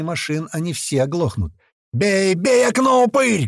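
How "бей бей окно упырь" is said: high, drawn-out yell; shouted command. A narrator's reading. Fighter urging his mate to smash window, shouting. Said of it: "кричит ему Котлет или Паштет".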